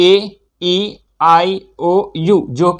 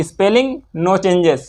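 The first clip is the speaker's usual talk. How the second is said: ordinary delivery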